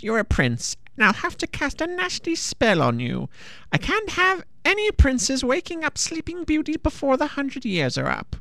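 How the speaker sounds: high, creaky witch voice. A narrator's reading. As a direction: play a cackly old witch. Witch speaking, same voice.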